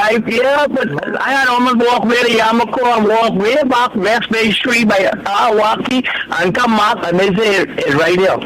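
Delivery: usual speaking voice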